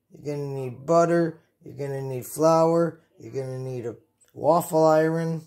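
ordinary talk, nothing marked

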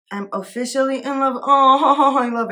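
delighted. Real, not acted. Delighted by a card.

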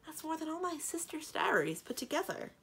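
high, astonished protest; incredulous. A narrator's reading. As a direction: exclaim it in disbelief. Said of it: "Cimmerian said incredulously".

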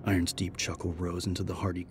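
plain reading voice

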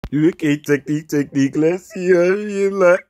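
normal talking voice

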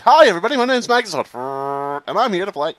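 high, loud, fake-cheerful intro; obnoxious youtuber. Shown as a demonstration. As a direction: sell it like an annoying streamer. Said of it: "be like".

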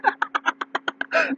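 a high, airy laugh; real laughter at the memory; high breathy laugh. Real, not acted. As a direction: giggle up high.

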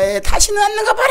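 high, loud wail; acted-out agonised scream; screamed labour threat. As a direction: yell it out mid-contraction, mimicking the labouring wife. Wife screaming through labour pains.

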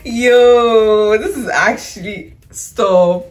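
loud, drawn-out "yo"; amazed exclamation. Real, not acted. Overcome by liking the purchase.

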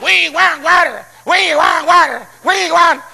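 slow, high-pitched protest chant; mock crowd shout. People gather against Moses, slow chanted shout. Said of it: "and shout".

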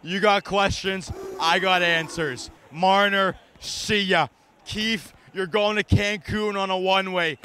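ordinary talk

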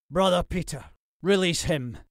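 firm, urgent command. Acted. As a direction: order him to let go. Lord orders Jack to release Percy.

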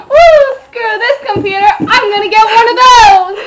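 loud, high whoops of delight; excited yelling. Pretend. Customer cheers loudly over the never-jamming computer. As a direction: cheer like you just won.

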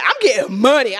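high-pitched excited crowing; gleeful boast. Retold or imitated. New comic thrilled at being paid, gleeful. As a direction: crow it with glee. Acting out thrill at earning money.

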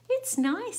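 high, loud chirpy bird. Acted. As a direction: pipe it out as a bird. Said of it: "says the bird".

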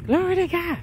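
very high glory exclamation; high praise cry. Real, not acted. Preacher praising God, high soaring cry. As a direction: sing it up high.

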